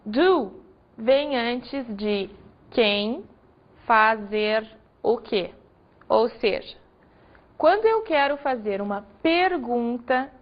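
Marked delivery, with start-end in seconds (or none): 0.0-5.5 slow, deliberate teacher pacing; slow rule. Shown as a demonstration. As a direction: spell the rule out slowly. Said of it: "teacher stresses the rule slowly".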